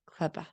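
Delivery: "klaba" as one blended word; words run together. Shown as a demonstration. Imitating a child blending "Close the box" into "klaba". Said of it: "they say".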